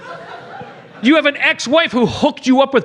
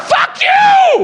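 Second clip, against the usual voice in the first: yelled high and loud; angry shout; 5 dB louder than usual. Real, not acted. Host raging at Tim Allen's character, loud shout. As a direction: blast it at the screen. Ranting at the movie.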